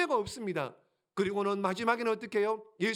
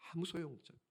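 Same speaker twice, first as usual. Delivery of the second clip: soft aside; 7 semitones lower, 12 dB quieter, much faster than usual. Real, not acted. Preacher repeats line.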